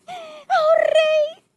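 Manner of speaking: a hint of pleading to the king. Servant to king, mildly voiced plea. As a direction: call out to the king, lightly begging. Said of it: "suplicándole".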